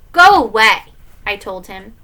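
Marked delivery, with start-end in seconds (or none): 0.1-0.8 loud, brusque send-off; cross kid calling through a door; angry dismissal. Acted. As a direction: shut Dad out, louder. Angry boy dismisses Dad at door.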